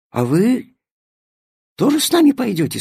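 put-on fast, high eager child. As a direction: ask it hopefully like a small child. Ребёнок спрашивает фею. (детский голос)

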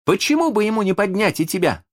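fast, high-pitched eager suggestion; eager exclamation. A narrator's reading. Питер proposes the kite rescue to Венди, eager exclamation. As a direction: rush the idea out excitedly. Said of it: "воскликнул Питер".

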